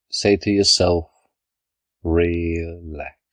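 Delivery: normal talking voice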